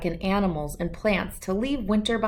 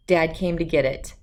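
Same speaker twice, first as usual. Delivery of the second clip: ordinary delivery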